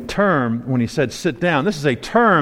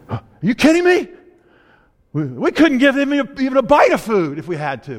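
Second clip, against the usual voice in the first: disbelief; 8 semitones higher than usual. Retold or imitated. Philip protesting to Jesus, incredulous. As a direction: balk at the request as if it's absurd. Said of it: "his reaction is".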